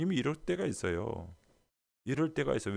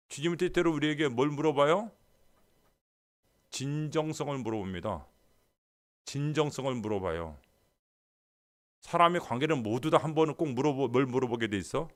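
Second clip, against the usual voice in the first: normal talking voice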